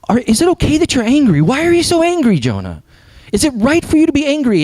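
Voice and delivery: high, urgent challenge; animated, high-pitched retold rebuke; retold insistent questioning. God questions angry Jonah, retold.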